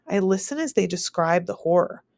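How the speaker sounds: ordinary delivery, nothing marked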